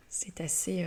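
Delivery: ordinary talk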